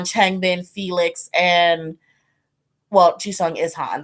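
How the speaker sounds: normal talking voice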